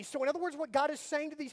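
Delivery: usual speaking voice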